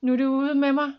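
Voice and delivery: loud, high despair; alarmed thought. A narrator's reading. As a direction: think it in falling panic. Thinks while falling to the ground.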